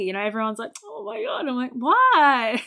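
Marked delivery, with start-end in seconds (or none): 0.8-1.5 very high, cooing imitation; mock gushing. Mimicking everyone gushing over baby. 1.7-2.6 loud, high comic protest; exaggerated retold incredulity; mock bafflement. Comic bafflement at others' emotion.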